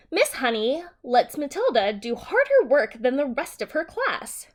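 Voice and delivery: ordinary delivery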